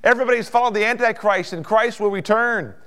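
high, loud, urgent proclamation; full-force preaching. Real, not acted. Preacher proclaiming Christ's return in glory, full-force preaching. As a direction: build to a ringing pulpit climax.

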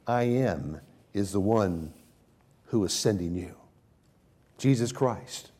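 ordinary talk, nothing marked

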